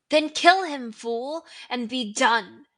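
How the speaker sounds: villain screeching at his servant; screeched order. Acted. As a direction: spit the command at the fool. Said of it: "screeched Voldemort".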